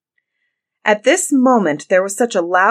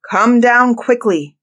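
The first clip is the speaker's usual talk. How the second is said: louder, higher, drawn-out command; shouted order; 5 semitones higher, 7 dB louder than usual. A narrator's reading. Bluebeard calling his wife down, shouted order. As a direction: order her down forcefully. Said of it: "cried Bluebeard".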